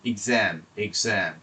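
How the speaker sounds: এক্সাম slowly, opening with 'ig'; correct pronunciation. Shown as a demonstration. Teacher models dictionary sound for students, careful pronunciation. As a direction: say এক্সাম as a drawn-out 'ig-ZAM'. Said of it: "উচ্চারণটা হচ্ছে এক্সাম".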